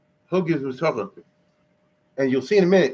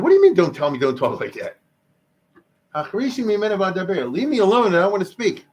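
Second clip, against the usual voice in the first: higher-pitched, exasperated pushback; indignant retort; 5 semitones higher, 3 dB louder than usual. Retold or imitated. Man answering people who silence his complaint, indignant retort. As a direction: protest it as if cutting off the scolders.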